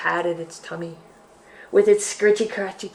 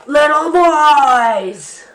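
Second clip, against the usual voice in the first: slow, loud, higher monster voice; monster reveal; 7 semitones higher, 11 dB louder, much slower than usual. Acted. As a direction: savour it like a hungry beast. Bear's reveal, big climax.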